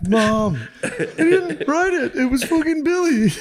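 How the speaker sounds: put-on high, slow whining child, laughing; whiny kid. Kid pleading with mom over a Mad Libs book, high whine.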